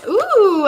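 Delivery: high, rising-then-falling ooh; pleasantly surprised "ooh"; intrigued ooh. Real, not acted. Tarot reader reacting to a new card, intrigued ooh.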